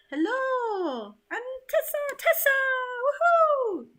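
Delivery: high, loud, bubbly voice; bubbly high greeting to Tessa; perky put-on greeter. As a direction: squeal the name like an excited fan. Greeting a guest in a voice.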